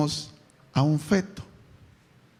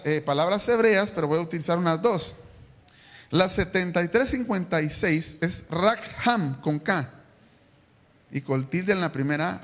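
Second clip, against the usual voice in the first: ordinary talk, nothing marked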